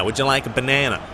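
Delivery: mock American accent offering a banana. Imitating Americans for his viewers, American accent. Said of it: "the way Americans say it".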